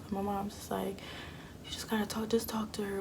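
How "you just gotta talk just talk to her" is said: soft unvoiced whisper, urging; hushed whisper. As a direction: whisper it so nobody else hears. Mom quietly urging behind door.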